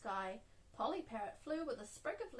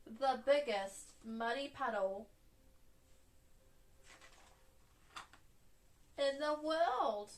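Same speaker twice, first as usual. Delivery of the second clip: slow, drawn-out build, louder at the end; big dramatic reveal; 4 dB louder, slower than usual. A narrator's reading. Storyteller reveals the biggest muddy puddle, slow and grand. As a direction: stretch each word towards a big finish.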